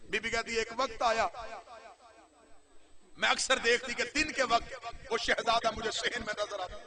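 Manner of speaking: soft, hushed mourning narration, near tears; hushed grieving recitation. Acted. Reciter telling the mourners of the lady's grief, in a hushed grieving voice.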